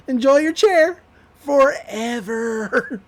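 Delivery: hammed-up villain's taunt; mock gloating. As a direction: gloat like a cartoon villain, stretching the end. Acts out gloating over stuck Stan.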